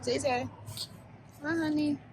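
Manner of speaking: soft high coo. Real, not acted. Mother greeting her baby softly.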